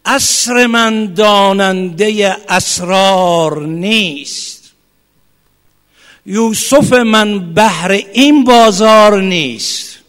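very slow, drawn-out poem reading; poem recitation. Real, not acted. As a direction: savour every word of the verse. (شعرخوانی بسیار آهسته)

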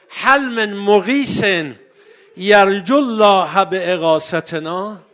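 3 call out across the field; 4 mournful loud plea; 1 crying for help. Acted. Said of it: "فریاد زد".

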